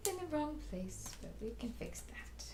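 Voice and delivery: whisper. Real, not acted. Whispered aside while crafting.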